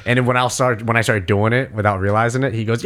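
usual speaking voice

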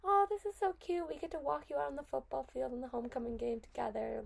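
mock parental delight sliding down in pitch; gushing parents. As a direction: imitate parents gushing over something cute. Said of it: "my parents were like".

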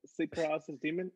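ordinary talk, nothing marked

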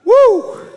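high, loud whoop; excited whoop. Real, not acted. Preacher firing up the room with a loud whoop. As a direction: let out a joyful whoop.

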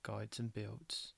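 flat deadpan monotone, slow and soft. Pretend. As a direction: drone it on purpose. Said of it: "in my plain, monotone voice".

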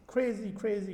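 normal talking voice

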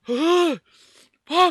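gasp, then high loud curse; pained shout. Real, not acted. Podcaster with burning mouth, gasping pained shout. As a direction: suck in air and cry out. Cursing in pain.

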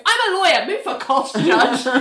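high-pitched lawyerly protest; hammed-up courtroom indignation; mock outburst. Lawyer protests to judge, retold.

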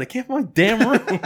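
high, laughing through the words; laughing complaint. Retold or imitated. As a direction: giggle out the complaint. Laughing retelling of being lost.

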